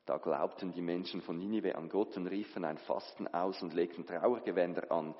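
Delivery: usual speaking voice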